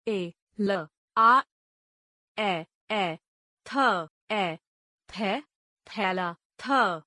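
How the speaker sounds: put-on slow classroom spelling chant for children; syllable-by-syllable spelling drill. Shown as a demonstration. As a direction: sound out each letter, then blend the word. Spells words letter by letter.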